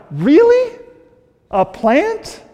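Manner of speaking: squeaky high disbelieving question; mock astonishment at the silly plant; incredulous God. Preacher voicing God asking Jonah about the plant, high incredulous squeak.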